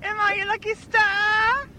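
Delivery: very high squeaky falsetto [name]; shrieking falsetto pop diva. Acted. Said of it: "[name] is the store owner".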